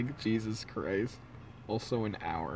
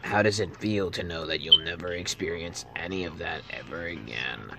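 sinister villain; 3 semitones lower than usual. Acted. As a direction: whisper doom like a killer. Villain threatening death.

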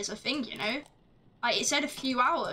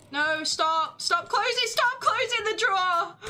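loud, much higher cry of protest; frustrated shout; 7 semitones higher, 5 dB louder than usual. Real, not acted. Player yelling at the game closing the drawer, loud. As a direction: yell at the game to stop.

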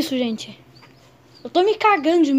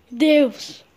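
ordinary delivery

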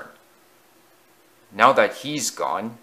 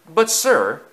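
higher, louder protest; objecting; 6 semitones higher, 5 dB louder than usual. A narrator's reading. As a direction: protest to the boss. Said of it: "objected Alec Greedy".

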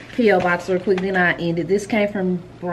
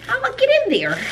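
much higher, squealing then giggling; gleeful squeal; 12 semitones higher, much faster than usual. Real, not acted. Vlogger eager to use the peach candle, high gleeful squeal.

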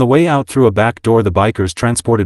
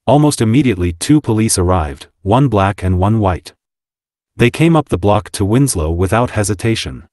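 usual speaking voice

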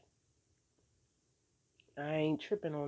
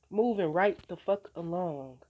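dismissive brush-off; 3 semitones higher, 7 dB louder than usual. Retold or imitated. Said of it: "Now I'm just like".

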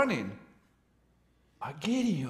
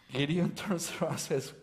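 normal talking voice

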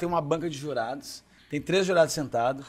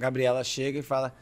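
ordinary talk

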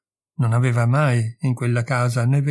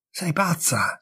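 Sei pazza! whispered hoarsely, no voice; whispered exclamation; 5 semitones higher, 4 dB quieter, slower than usual. A narrator's reading. Jacques telling Irene she is mad, in a whisper. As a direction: hiss out the word pazza with a falling end. Lover refuses to keep Irene.

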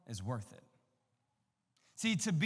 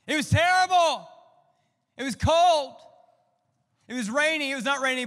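loud, high hammed-up complaint; dramatic exaggeration; 9 semitones higher, 12 dB louder than usual. Pastor hamming up the miserable photo shoot, loud and high. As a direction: ham up how bad it was. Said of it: "I'm trying to make it worse".

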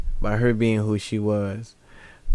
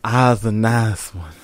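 put-on Southern accent; 9 dB louder than usual. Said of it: "That's exactly how it sounded".

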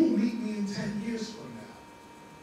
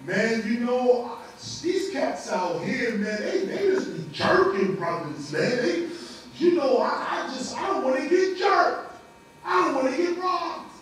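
anxious protest; 3 semitones higher, 7 dB louder than usual. Acted. Scared man voiced in lesson.

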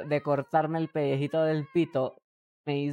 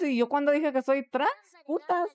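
rising, higher mock outrage; mock indignant; 9 semitones higher than usual. Streamer fakes outrage at chat's question, pitch rising high. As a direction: play offended, pitch climbing to a squeal.

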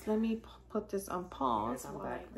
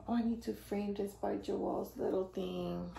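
ordinary delivery

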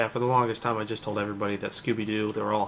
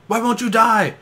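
higher, louder frustrated yell; 11 semitones higher, 10 dB louder, slower than usual. Retold or imitated. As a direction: yell in movie-villain exasperation. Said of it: "I feel like Austin Powers".

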